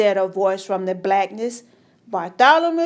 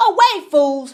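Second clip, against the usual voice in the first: louder, higher, sharp dismissal; angry shout; 5 semitones higher, 5 dB louder than usual. A narrator's reading. King shouts the magicians out of his sight. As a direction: drive them out of the room. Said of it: "he shouted".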